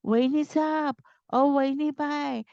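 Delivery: high-pitched urgent calling, lightly acted breathlessness; out of breath. A narrator's reading. Maid running in to her mistress, calling out breathless. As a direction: call out after running, barely able to speak. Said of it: "तिला इतकी धाप लागली".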